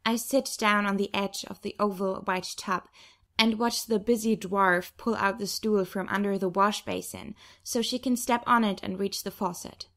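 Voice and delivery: usual speaking voice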